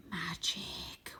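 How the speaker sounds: soft, hushed unvoiced whisper; mysterious whisper of a single word. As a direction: breathe the word like a secret. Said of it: "Wynne whispers".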